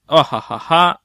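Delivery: mock villain laugh, ojajaja; evil laugh. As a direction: cackle like a cartoon villain.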